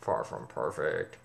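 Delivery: a low, slow muttered thought; private thought. Retold or imitated. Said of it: "but I was thinking".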